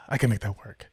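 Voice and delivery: sigh, then give in low and soft; low, quiet, sighing concession; reluctant agreement. Retold or imitated. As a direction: imitate a put-upon guy grudgingly agreeing. Retelling driver's reluctant reply.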